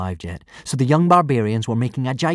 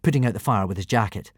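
plain reading voice

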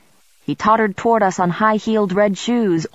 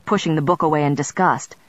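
ordinary delivery

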